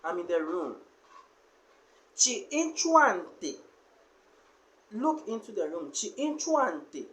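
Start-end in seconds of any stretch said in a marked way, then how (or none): 2.1-3.5 Chi inchuante slowly, higher and louder, with the N before chuante; a slow, raised demo of Chi inchuante; Chi inchuante said slowly, higher and loud, stressing the N; nasal N demo. Shown as a demonstration. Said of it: "So the N must come". 5.9-7.0 a slow, raised demo of Chi inchuante; nasal N demo. Shown as a demonstration. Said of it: "So the N must come".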